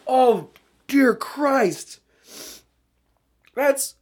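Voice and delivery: slow, high, pained groan. Real, not acted. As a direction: drag it out in agony. Pained by the taste.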